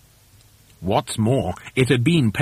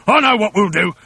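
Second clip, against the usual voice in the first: loud, higher, eager voice; raised-voice husband with a scheme; Mister Twit crying out; 5 semitones higher, 5 dB louder than usual. A narrator's reading. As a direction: announce the plan excitedly. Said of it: "cried Mister Twit".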